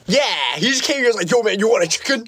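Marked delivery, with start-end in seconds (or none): 1.3-2.2 lightly imitated buddy, high and casual; friend's excited offer. Retelling friend's offer to viewers, lightly mimicked high voice. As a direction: pitch it up as the friend's greeting.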